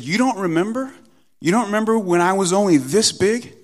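high, loud incredulous questioning; mock disbelief. Dad quizzing his three-year-old, mock disbelief. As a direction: feign amazement at a small child.

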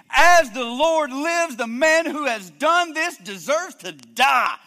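David swearing judgment in a fury; furious outburst. Acted. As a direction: roar it like a king betrayed. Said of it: "see how emphatic? He's angry".

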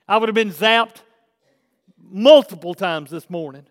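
loud, rising preacher's build; preaching at full force. Acted. Pastor preaching to his church, full force. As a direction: drive it home to the church.

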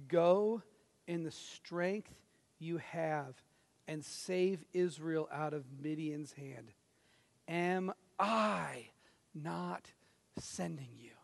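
soft, slow and reassuring; tender God. Retold or imitated. God answering Gideon's complaint, soft and tender. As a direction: gently lift up a frightened man. Pastor voices God's gentle answer.

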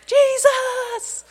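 a high, loud call across a crowd; imitating a man calling out from a tree; hooting and hollering. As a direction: holler his name to get noticed. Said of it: "Should I hoot and holler".

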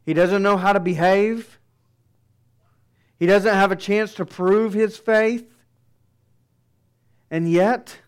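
loud, high preaching cadence; fiery sermon delivery. Acted. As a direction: preach it with full force to the congregation. Preaching at full force.

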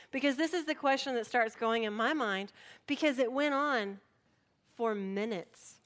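normal talking voice

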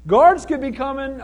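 high, loud, drawn-out call to be let in; mock-impatient plea from outside; anxious calling out. Peter stuck outside the gate, calling out anxiously. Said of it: "Pete's out there going".

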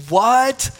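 surprised what. Retold or imitated. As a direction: blurt it out in disbelief.

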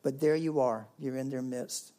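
ordinary talk

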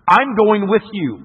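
put-on a boy declaring he's coming, no arguing; child's firm insistence.

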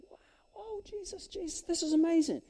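high, very soft, hushed amazement; awed disciples. Acted. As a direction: gasp it out small and high. Plays awed disciples as Jesus passes.

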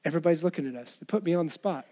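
low, soft mock dismay; rulers caught out. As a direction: mutter it like someone put on the spot. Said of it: "religious rulers being like".

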